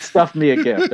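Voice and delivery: laughing through the repeat, pitch up. Real, not acted. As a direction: crack up while repeating the phrase.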